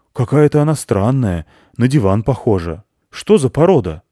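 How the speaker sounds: loud, doubtful gruff questioning; a hunter eyeing the odd dog; loud puzzled probing; suspicious hunter. Acted.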